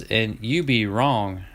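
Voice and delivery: slow Southern drawl on you be wrong. Shown as a demonstration. To viewers who think they know, in Southern drawl. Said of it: "is that Georgia talk?".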